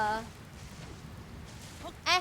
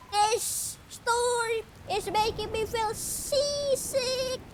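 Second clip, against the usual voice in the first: high, slow, queasy mock-sick voice; hammed-up seasick high voice; seasick sailor; 11 semitones higher, slower than usual. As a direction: groan it out like you're about to be sick. Voicing seasick NPC.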